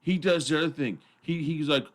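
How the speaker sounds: ordinary talk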